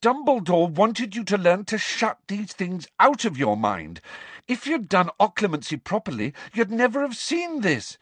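high, insistent reproach; heated, high-pitched argument; fierce scolding. A narrator's reading. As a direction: lecture a friend in a quarrel. Quarrel continues, scolding the friend.

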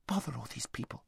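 hushed irritated aside; irritated thought. A narrator's reading. Aravis thinking to herself about the crowd, irritated whisper. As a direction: grumble it under your breath, whispering.

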